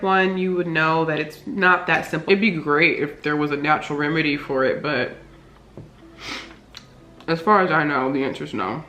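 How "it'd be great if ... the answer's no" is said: mouth full. Real, not acted. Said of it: "Sorry I'm talking with my mouth full".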